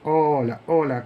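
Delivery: ordinary talk, nothing marked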